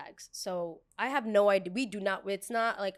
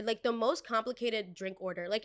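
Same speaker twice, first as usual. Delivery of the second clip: usual speaking voice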